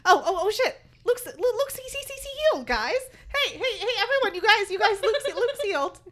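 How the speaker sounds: high, loud excited announcing; Clary suddenly shouting to the group that Luke is healed; excited blurt. Retold or imitated. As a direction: burst out with the good news to everyone. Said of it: "'Cause she's like".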